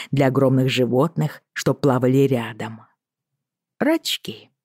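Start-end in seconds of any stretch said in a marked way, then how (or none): 3.8-4.5 lilting Irish whisper, lightly voiced; Irish accent. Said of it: "с певучим ирландским акцентом".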